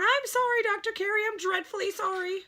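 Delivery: high, hurried apology; small voice, dreadfully sorry; anxious little Stuart. A narrator's reading. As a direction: plead forgiveness in a tiny voice.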